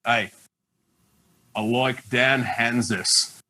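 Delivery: low, slow Australian drawl on "I like Dan Hanzus"; deadpan Aussie bloke naming his favourite, slowly; Aussie accent. Retold or imitated. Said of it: "He's doing Aussie accent impersonations".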